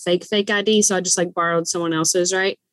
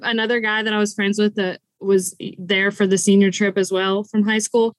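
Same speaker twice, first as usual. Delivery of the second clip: normal talking voice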